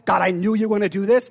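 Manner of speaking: ordinary delivery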